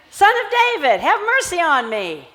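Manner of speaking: high, loud desperate cry for mercy; crying out loudly. Acted. Blind man shouting over the crowd, loud and desperate. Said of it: "he cried out even more loudly".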